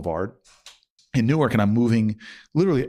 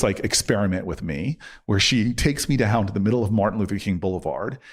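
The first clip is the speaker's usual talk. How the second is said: normal talking voice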